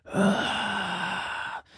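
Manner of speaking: imitated eerie groan, high and breathy; ghostly groan. Friend retelling a ghost recording, imitated eerie groan. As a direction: mimic a creepy voice on the recording with a short ugh. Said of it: "come right up to his phone and gone".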